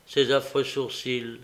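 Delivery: plain reading voice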